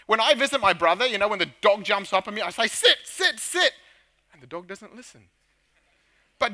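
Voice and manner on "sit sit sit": high, sharp repeated order; mock-urgent orders to an excited dog; urgent dog command. Man telling brother's dog to get down, high-pitched command.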